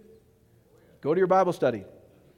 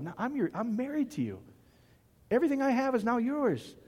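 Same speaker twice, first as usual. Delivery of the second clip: softer, gentle loving reassurance; tender voice of God; 3 semitones higher, 6 dB quieter than usual. Acted. God tells his people he is love, tender and soft.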